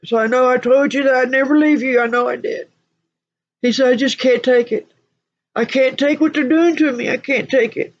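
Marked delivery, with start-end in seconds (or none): 0.2-2.7 high, pleading retelling; imitating sobbing man. Michael owning up to his promise, imitated sobbing. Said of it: "he's sobbing". 3.9-7.9 high, anguished pleading; imitating sobbing man. Michael on what they're doing to him, imitated sobbing. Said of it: "He just cried and he's sobbing".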